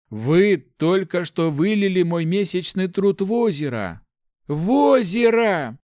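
loud, high, drawn-out indignation; building to an outraged cry; outraged protest. A narrator's reading. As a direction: lament the loss furiously. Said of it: "зашипел паладин".

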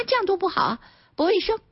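high-pitched mock scolding busybody; meddling critic. Lawyer mimicking busybodies criticizing how you hold your phone, mock disapproving. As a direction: imitate a fussy meddler, pitched well up. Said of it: "好多人在指导你".

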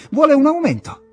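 sudden high, loud mock exclamation; scornful "ah, so that's it"; mocking realization. Egström mocks the guessed motive.

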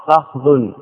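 فخذ as fikh-dhun; two-syllable فخذ: FIKH-dhun; lightened, kasra first. Shown as a demonstration. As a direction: say فخذ with i after fa, no vowel on kha. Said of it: "ويجوز التخفيف بإسكان".

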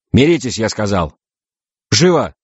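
impatient order, climbing high at the end; insist, brooking no argument; commanding. Acted. Navigator orders captains to make peace, commanding.

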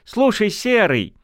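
high, slow gleeful call; delighted. Acted. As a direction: call out half laughing. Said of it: "Чуть ли не в восторге".

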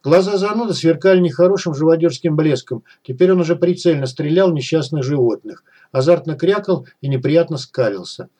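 ordinary delivery, nothing marked

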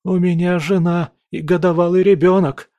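high, loud, panicked begging; desperate plea. Acted. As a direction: cry out for your family. Prisoner begging for life.

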